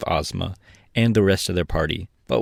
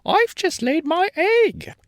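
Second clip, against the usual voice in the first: hen voice; slower than usual. A narrator's reading. Billina voiced.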